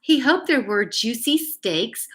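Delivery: put-on reading a picture book aloud to kids; gentle storyteller read; story reading.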